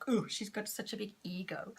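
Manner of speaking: hushed, breathy mock gossip; mock whisper. Mocking women with big egos, in a whisper. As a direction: whisper it like a catty aside. Mocking song-style judgment.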